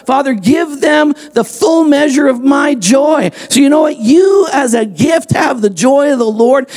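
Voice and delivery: high, rising shouted exhortation; fiery preaching. Acted. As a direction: preach it to the back row. Preaching at full force with music.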